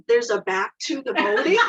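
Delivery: loud, incredulous rising question; cute freak-out. Retold or imitated. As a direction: imitate his startled disbelief. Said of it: "freaking out in the cutest way".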